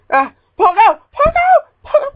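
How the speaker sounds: high crying shout; anguished cry. Real, not acted. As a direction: cry out in despair. Character falling.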